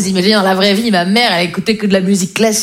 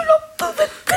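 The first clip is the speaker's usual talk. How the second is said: high aside; 4 dB quieter, much faster than usual. Acted. Quick aside voice.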